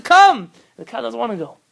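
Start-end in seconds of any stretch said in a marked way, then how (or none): 0.0-0.4 high, very loud call; shouted command. Retold or imitated. Rabbi pulling his cow along, high shouted order. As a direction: shout it to budge a stubborn animal.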